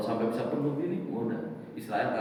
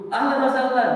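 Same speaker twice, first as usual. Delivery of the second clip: loud welcome; 9 semitones higher, 8 dB louder than usual. Acted. Greeting at heaven's door.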